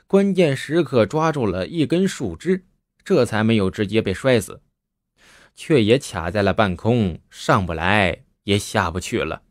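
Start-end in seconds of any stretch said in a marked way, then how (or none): none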